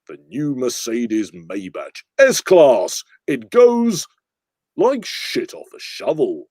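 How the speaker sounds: rise, pause, drop for the punchline; slow Clarkson car review; Jeremy Clarkson. Acted. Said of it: "Jeremy Clarkson".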